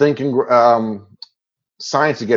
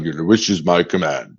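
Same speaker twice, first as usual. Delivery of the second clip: genie voice; 6 semitones lower than usual. Retold or imitated. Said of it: "You almost did a pretty perfect impression".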